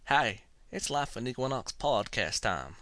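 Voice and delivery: put-on Southern accent, quoted at ordinary pace; horrible southern accent. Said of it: "my horrible southern accent".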